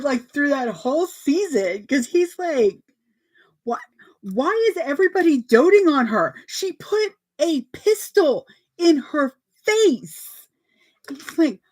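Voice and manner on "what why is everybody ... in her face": high, mock-indignant complaint; imitating an upset fan, protest in outrage; indignant rant. Boyfriend mad at Chibiusa.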